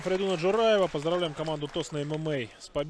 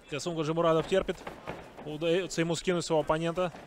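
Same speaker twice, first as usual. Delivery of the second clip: live play-by-play; wrestling commentator; 4 dB quieter than usual. Acted. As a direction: call the action as it happens.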